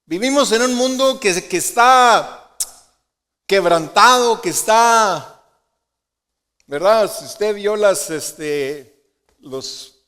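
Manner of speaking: loud, high impassioned preaching; preaching at full force. Real, not acted. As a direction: pour it out with urgency. Preacher at peak intensity. (predicando apasionado, alto y fuerte)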